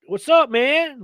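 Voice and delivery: high twangy country drawl; Southern country guy; country accent. Retold or imitated. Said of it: "he said his country accent".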